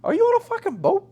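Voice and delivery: high, loud incredulous client impression; mock incredulity. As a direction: blurt it out like a client catching you out. Client catches him out, repeated.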